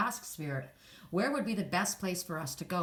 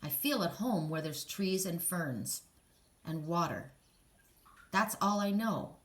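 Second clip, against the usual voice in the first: ordinary talk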